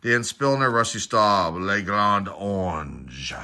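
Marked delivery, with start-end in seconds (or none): 1.5-3.3 slow, drawn-out mock French accent; hammy French accent, dragged out slowly; bad French accent. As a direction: put on a really bad French accent, stretching the words. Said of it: "really bad French accent".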